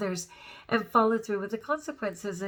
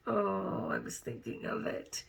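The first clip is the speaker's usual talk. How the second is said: whispered to self; 6 dB quieter than usual. Real, not acted. Searching memory, unvoiced.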